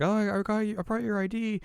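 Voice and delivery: put-on high, light girlish quote; voiced timid girl. Voices shy girl returning ID.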